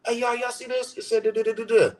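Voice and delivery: eager announcing. Retold or imitated. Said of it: "what I'm doing when I'm putting these posts up".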